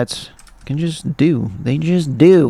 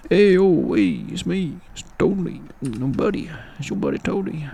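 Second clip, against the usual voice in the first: soft, higher little character voice; Tony; 3 semitones higher, 6 dB quieter than usual. Pretend. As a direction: lightly voice a cartoon buddy under your breath.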